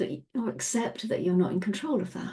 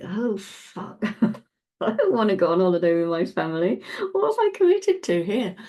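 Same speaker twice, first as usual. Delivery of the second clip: dismayed inner voice; 3 dB louder than usual. Retold or imitated. Inner voice after holiday plan.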